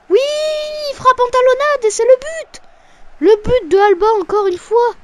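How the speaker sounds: high, loud frantic goal call; frantic sports commentary. Real, not acted. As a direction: shout the goal like a live commentator. Goal scored, frantic play-by-play.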